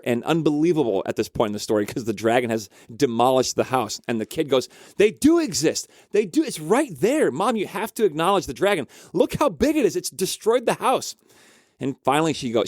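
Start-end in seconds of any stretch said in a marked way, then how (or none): 5.0-11.1 put-on high, insistent child pleading; acted kid's protest. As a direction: protest up high like an exasperated kid. Kid argues back at mom.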